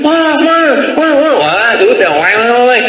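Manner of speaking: put-on high whiny small-child wail; fussing child. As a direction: whine like a toddler wanting attention. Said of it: "Anak kecilnya tambah ribut lagi".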